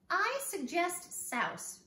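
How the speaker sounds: put-on high, loud and slow, like a proud boy; slow, high suggestion. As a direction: offer the word slowly and grandly. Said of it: "remarked George".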